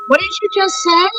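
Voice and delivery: high-pitched retold incredulous question; shocked disbelief. Mother asking the stranger who named her daughter, shocked. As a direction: reenact needing to hear it confirmed, voice jumping up.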